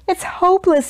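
high, exasperated wail; despairing cry. A narrator's reading. Baker with his last piece of dough, high despairing cry. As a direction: give up in despair, voice pitched high. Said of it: "he cried".

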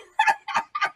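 very high squeal of laughter; squeaky laugh. Acted. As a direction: cackle at your own joke. Dummy laughs at own joke.

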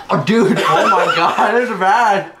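high, laughing in disgust. Real, not acted. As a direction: laugh through the gag. Tasting the awful fruit.

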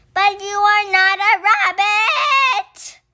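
high, loud objection; loud protest. Acted. Squirrel objecting loudly to Fox. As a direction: cry out that he is no rabbit.

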